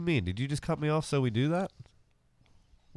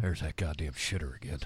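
put-on low grumpy old man; 7 semitones lower, 9 dB quieter than usual. Neighbour voiced, angry old man.

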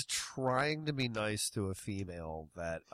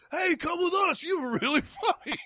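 high, gushing fan voice; excited fans; 3 dB louder than usual. Retold or imitated. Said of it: "people from the show that were just like".

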